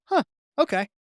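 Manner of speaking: mock surprised recruiter giving in; grudging recruiter. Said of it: "they go".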